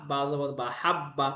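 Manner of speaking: হাব্বা said slowly, ব held twice; doubled consonant. Shown as a demonstration. Teacher demonstrating tashdid on হাব্বা, slow and doubled. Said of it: "তাসদিদ ওয়ালা হরফ দুইবার পড়তে হয়".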